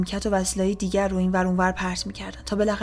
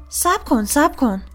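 raised, urgent high voice; operator's shout; 6 semitones higher, 5 dB louder, slower than usual. A narrator's reading. Police operator shouts to the caller, urgent yell. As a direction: call out sharply to stop the caller. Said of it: "اپراتور داد زد".

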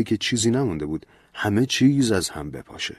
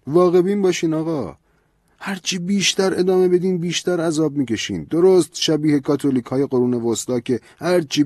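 higher, louder, insistent priest; lecturing priest; 9 semitones higher, 5 dB louder than usual. A narrator's reading. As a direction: scold him with the priest's rising fervour. Priest lectures Ben at length.

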